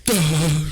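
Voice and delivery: loud, blunt "duh" noise; exaggerated smoking sound effect; mimed toking noise. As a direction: act out a stoned puff. Mimes getting high outside the airport.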